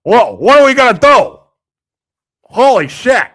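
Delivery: mock panic. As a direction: play it like a witness freaking out. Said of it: "They don't go".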